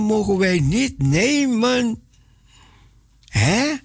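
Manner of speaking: usual speaking voice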